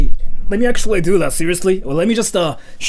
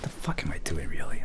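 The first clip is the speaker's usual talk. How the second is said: whispering; 10 semitones lower, 10 dB quieter than usual. Real, not acted. Embarrassed after the voice acting.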